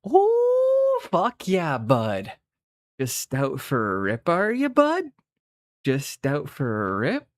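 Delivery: whooping, hammed-up Canadian hoser; broad Canadian accent with high swoops and drawn-out words. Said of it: "we can all turn the accent on".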